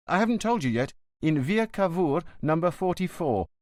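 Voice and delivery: a worried son pleading with the inspector; lightly voiced nervous young man.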